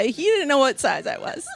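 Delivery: normal talking voice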